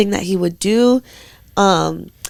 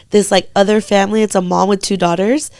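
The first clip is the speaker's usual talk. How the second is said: ordinary talk, nothing marked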